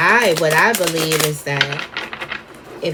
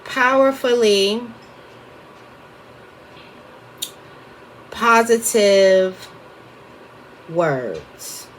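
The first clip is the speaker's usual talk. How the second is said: slow typing out; 2 semitones higher, much slower than usual. Shown as a demonstration. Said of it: "I'm just gonna type".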